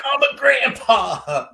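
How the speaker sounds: high, slow, savoured with joy; delighted repeat. Retold or imitated. Father repeating the news to the host, high and slow with delight. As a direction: stretch it out, beaming with pride.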